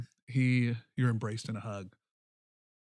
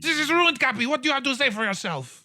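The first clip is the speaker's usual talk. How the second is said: high, loud frantic scolding; stomping mayor berating Cappy; furious mayor; 8 dB louder, faster than usual. Acted. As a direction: rant at him like a ruined politician.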